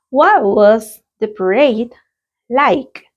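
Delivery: slow, clear question for the class; a teacher's clear model question. Shown as a demonstration. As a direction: read it out slowly and clearly. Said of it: "Let's practice the pronunciation".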